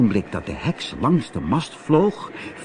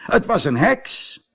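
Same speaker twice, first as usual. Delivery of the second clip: sailor; 8 semitones higher than usual. A narrator's reading. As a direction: say it like an amazed sailor. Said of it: "zei een van hen".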